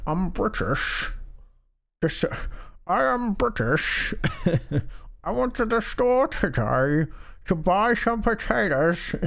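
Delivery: high, mushed-mouth kid attempting British; squeaky, slurred mock-British child voice; child's British accent. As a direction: imitate a little girl's bad British accent, mouth mashed together. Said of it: "her try to repeat my accent".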